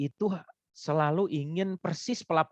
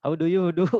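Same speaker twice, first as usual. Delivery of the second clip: English greeting with a light Sundanese lilt, a bit louder; Sundanese-accented English; 3 semitones higher, 5 dB louder than usual. Retold or imitated. Lecturer shows English in Sundanese tones, light Sundanese accent. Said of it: "menggunakan nada-nada atau logat Sunda".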